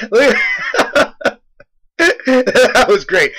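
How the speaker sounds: real laugh running through the words; can barely get it out for laughing; slow, breathless laughter through the words. Real, not acted. Watching his brother fall, laughing.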